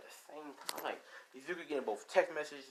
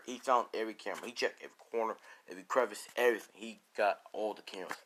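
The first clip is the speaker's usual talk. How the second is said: ordinary delivery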